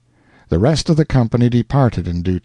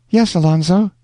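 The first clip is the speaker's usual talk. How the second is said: put-on tender young woman; 7 semitones higher than usual. Rosanna answers her lover.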